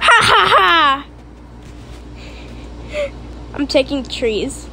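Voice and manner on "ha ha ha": spelt-out triumphant ha-ha-ha; gloating laugh. Real, not acted. Child who grabbed the axe first, gloating laugh.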